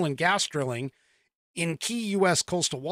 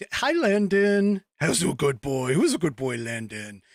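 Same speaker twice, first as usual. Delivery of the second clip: doggy talk; 2 semitones lower than usual. Real, not acted. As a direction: coo at Landon like he's a puppy. Talking to the family dog.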